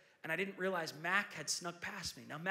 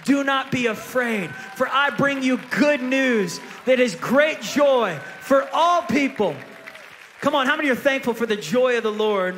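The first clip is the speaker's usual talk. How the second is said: preaching at full force; 6 semitones higher, 16 dB louder than usual. Acted. Pastor firing up the church with good news, loud preaching. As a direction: build it up and drive each line home to the crowd.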